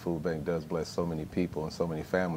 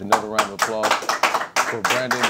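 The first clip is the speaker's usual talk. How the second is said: louder and higher, rallying the crowd; host leading the cheer for his guests, loud and raised; calling for applause; 3 semitones higher, 6 dB louder than usual. Real, not acted. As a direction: pump up the audience for the guests.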